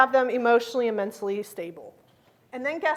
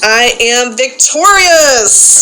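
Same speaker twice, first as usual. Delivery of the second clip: louder, slow declaration, stretching out victorious; loud, drawn-out creed line; 16 dB louder, much slower than usual. Acted. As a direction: state it firmly as the creed's final line. Leads daily creed recitation.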